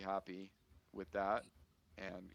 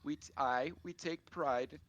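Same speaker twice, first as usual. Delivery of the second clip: proud farm wife; 7 semitones higher, 5 dB louder than usual. Acted. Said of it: "pleased with the compliments".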